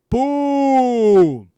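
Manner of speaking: high, loud mic-drop shout of Boom; booming victory call; triumphant shout. Real, not acted. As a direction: slam it home like a winning blow. Exclaims triumph after example line.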